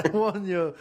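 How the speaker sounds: wobbly, hammed-up French accent. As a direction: warn them in dubious mock French. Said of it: "what accent I'm doing right now".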